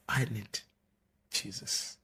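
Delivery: very soft, halting confession; hushed retold plea. Crying convert answers the girl who asked, hushed. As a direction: let the words drop almost to a whisper.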